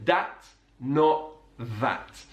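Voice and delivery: "That" said with a D, like "Dat"; slow, careful "Dat, not that"; D sound for th. Retold or imitated. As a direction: say "That" as "Dat" with a hard D. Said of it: "So he says".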